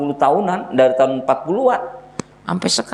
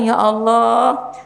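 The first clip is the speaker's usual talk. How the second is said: tender doting; 6 semitones higher, much slower than usual. Retold or imitated. Tender mother.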